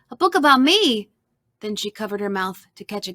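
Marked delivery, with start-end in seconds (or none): 0.1-1.1 high, loud squeal of delight; excited squeal. A narrator's reading. Sarah delighted by the book about her, excited squeal. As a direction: squeal it out, higher and louder. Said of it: "she squealed".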